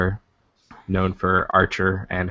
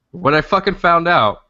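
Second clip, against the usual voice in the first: higher and louder, bursting with the punchline; excited outburst; 10 semitones higher, 6 dB louder than usual. Real, not acted. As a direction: blurt it out, thrilled. Punchline of the funny story.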